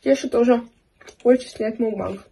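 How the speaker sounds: eating pizza while speaking; talking while chewing. Real, not acted. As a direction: mouth partly full of food. Seen 1: Bites slice at -3; holds it, mouth working while speaking.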